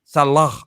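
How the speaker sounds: Salah ending in a breathy ha, SAH-lahh. Shown as a demonstration. Host tries the proper Salah with a final h. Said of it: "it meant the h at the end".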